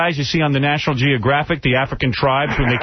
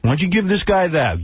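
ordinary talk, nothing marked

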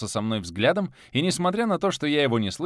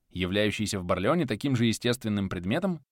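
ordinary delivery

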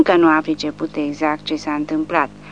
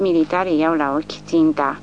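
ordinary delivery

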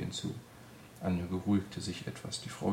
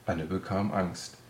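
ordinary delivery

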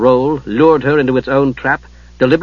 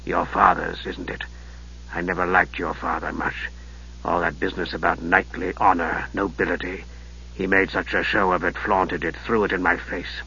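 low, soft, sinister mage; Raistlin's voice; 7 semitones lower, 10 dB quieter than usual. Acted. Raistlin greeting his nephew, low and softly sinister. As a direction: hush it like a frail dark wizard.

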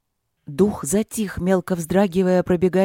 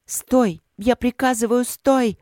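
commanding shout; 6 semitones higher, slower than usual. Acted. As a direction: order it to stop. Queen commands the fire spirit.

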